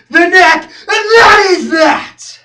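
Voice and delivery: slow, high, full-force yell; drawn-out roar rising in pitch; climactic shout. Acted. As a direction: scream each word as the final verdict. Climax of rant.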